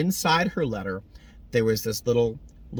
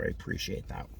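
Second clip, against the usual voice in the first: whispered thanks; 10 dB quieter than usual. Real, not acted. Emotional thanks to Donna.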